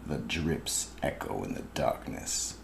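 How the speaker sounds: ordinary talk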